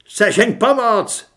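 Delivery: loud, slow, high shout for help. Acted. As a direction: yell it out in panic. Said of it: "zakřičel Torres". (Torres křičí o pomoc)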